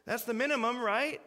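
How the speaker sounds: anxious waitress. Retold or imitated. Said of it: "she says".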